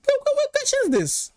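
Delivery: high, startled cry of disbelief; viewer's odd question read out, met with a high startled squeal; surprised squeal. Real, not acted. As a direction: yelp at the question in shock. Shocked at a chat question.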